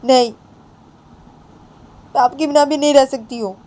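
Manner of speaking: ऊँची आवाज़ में रोती-सी लड़की; upset young girl. Acted. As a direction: beg not to be sent away, voice high and strained. Bani refusing again, upset.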